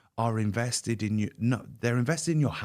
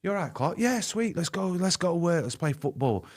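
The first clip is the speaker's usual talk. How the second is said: mock-chirpy, higher voice put on for teammates; fake cheerful front; 8 semitones higher than usual. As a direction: brush it off brightly, pitch high, as if nothing is wrong.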